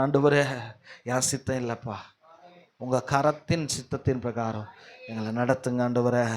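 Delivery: low, hushed plea to God; submissive prayer. Shown as a demonstration. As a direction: pray softly in humble surrender. Models a prayer of surrender. (தாழ்ந்த குரலில் பணிவான ஜெபம்)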